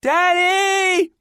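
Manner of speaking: put-on very high, long loud child's call; far-off cry. A narrator's reading. Girl overboard answering her father, calling from far off. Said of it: "her voice far off".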